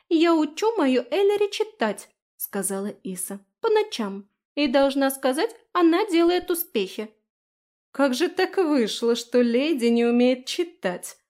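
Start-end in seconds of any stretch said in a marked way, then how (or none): 0.1-2.1 high, loud little fairy voice; tiny voice speaking up boldly; defiant fairy. A narrator's reading. Little fairy proudly defends her charge. 3.6-7.1 high, loud tiny voice; high-pitched fairy insistence; defiant fairy. A narrator's reading. Little fairy proudly defends her charge. 7.9-11.2 loud, slow, sneering question; raised, disdainful incredulity; scornful snort. A narrator's reading. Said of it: "фыркнула Фелиция".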